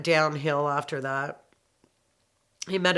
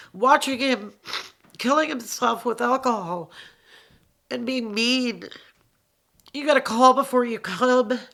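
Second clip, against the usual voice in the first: near tears; 7 semitones higher, 4 dB louder than usual. Real, not acted. Grieving her father's death.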